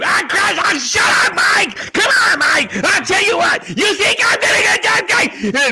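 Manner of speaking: high, loud, shouting radio host rant; Mad Dog impression. Radio host shouting down his co-host Mike, Mad Dog style. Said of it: "when Mad Dog".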